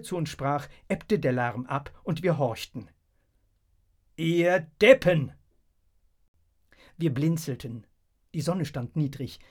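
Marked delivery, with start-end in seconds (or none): 4.2-5.3 loud, slow, drawn-out insult; scornful call. A narrator's reading. Leader mocking the crowd of throwers, loud scorn.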